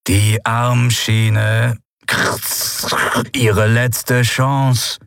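very slow, cold and deliberate, with a slight mechanical edge; a machine that sounds nearly human, spacing every word; a calm, menacing android without its helmet; almost human machine voice. Acted. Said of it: "Ohne den Helm klang sie fast menschlich".

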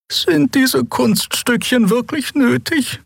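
high, slow and drawn-out disdain; weary, unimpressed lady; languid high voice. Acted. Xea lounging, asks the pilot if tricks are needed, slow and high.

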